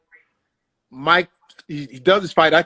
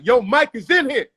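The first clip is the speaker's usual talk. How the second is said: raised, louder call across a club; yelled warning; 7 semitones higher, 5 dB louder than usual. Retold or imitated. Man with Mike alerting the comedy club, calling out. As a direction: pitch it up and call it out. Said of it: "the dude yell out".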